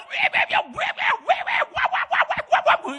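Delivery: high shouted weh wah calls; mock yelling. Preacher mocking spouses yelling across the room. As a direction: yell across a distance in mockery. Mimicking couple yelling far apart.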